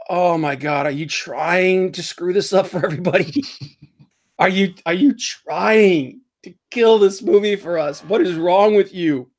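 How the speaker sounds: exasperated publicist. Retold or imitated. Publicists horrified by her interview, mock exasperated. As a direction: throw up your hands at the star.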